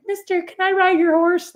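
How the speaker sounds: put-on very high child voice, pleading; little girl asking. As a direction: ask like a tiny girl tugging pants. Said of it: "I tugged on the guy's pants and I was like".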